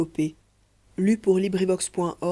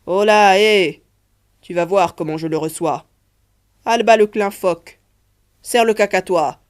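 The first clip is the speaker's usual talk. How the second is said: loud, higher captain's call to the crew; shouted orders; 3 semitones higher, 8 dB louder than usual. Acted. Captain to his crew as the squall comes, shouting orders. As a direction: call out commands over the deck.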